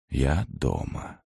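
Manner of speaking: quiet relief. A narrator's reading. Narrator calming after a nightmare, quiet relief. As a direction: say it slowly, settling.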